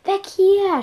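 high, loud shout to flee; panicked cry of alarm. Acted. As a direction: yell at everyone to run. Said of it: "schrie ich".

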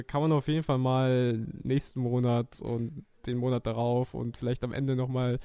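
usual speaking voice

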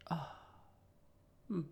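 ordinary talk, nothing marked

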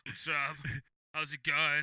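a badly faked lady voice, congested; attempted lady voice. Shown as a demonstration. Demonstrating a lady voice for listeners, congested attempt. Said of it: "still do a lady voice".

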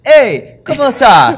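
hammed-up Argentine accent, bright greeting; papá hablando argentino. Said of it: "Hablaba argentino". (imitando el acento argentino, agudo y fuerte)

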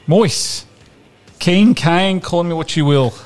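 podcast host ranting at a footballer, comically exasperated; mock rant. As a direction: rail at the player like a fed-up fan. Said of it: "this is my rant".

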